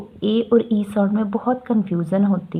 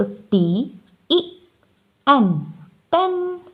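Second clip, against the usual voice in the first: slow sounding out; 4 semitones higher, much slower than usual. Shown as a demonstration. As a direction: spell "टी ई एन" slowly, then blend into "टेन" higher. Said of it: "और अगर हम ई sound बोलेंगे".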